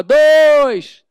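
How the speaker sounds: loud, high counting shout; crowd shout. Retold or imitated. The crowd counts the pie victims, loud shout. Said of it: "a turma gritava".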